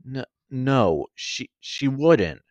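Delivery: a touch of shakiness, stammering denial; shaky voice. A narrator's reading. Medusa refusing to believe what he says about Athena, slow and shaky. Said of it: "I said with a shaky voice".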